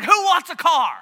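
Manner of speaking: exasperated teacher shouting to the class; exasperated outburst. Retold or imitated. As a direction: blurt it out like a teacher at the end of his rope. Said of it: "he basically got exasperated".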